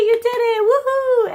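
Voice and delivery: high, loud whooping praise; cheering mom. Retold or imitated. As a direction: celebrate the child like he won a race. Mom cheering her kids.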